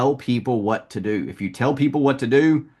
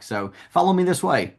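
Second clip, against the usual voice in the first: lightly acted salesman's lead-on, pitched higher; salesman taking control; 8 semitones higher than usual. Shown as a demonstration. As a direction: lead the customer off, voice lifted. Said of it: "You need to incorporate".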